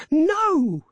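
very high, loud and exultant; triumphant cry. A narrator's reading. Wife overruling her husband, triumphantly. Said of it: "she cried triumphantly".

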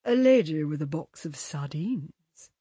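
slow, solemn pronouncement; measured, mock-solemn delivery; grave. A narrator's reading. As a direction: intone it gravely, like a picture title. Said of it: "said Dennis gravely".

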